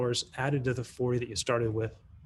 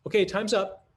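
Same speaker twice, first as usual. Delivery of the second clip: calling time; 7 semitones higher, 5 dB louder than usual. Shown as a demonstration. Teacher calling class back.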